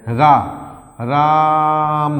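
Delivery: राम with the आ stretched very long and slow; drawn-out vowel. Shown as a demonstration. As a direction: hold the आ in राम like a pluta vowel. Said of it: "खींच देते हैं कि". (राम को बहुत खींचकर बोलना)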